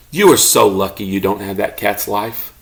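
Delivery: ordinary delivery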